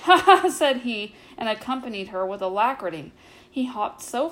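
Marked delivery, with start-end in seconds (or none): 0.0-0.5 high, very loud mock laugh; loud cawing laugh. A narrator's reading. The doctor answering Ribby at the smithy, loud cawing laugh. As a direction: burst out with a sharp storybook "ha ha".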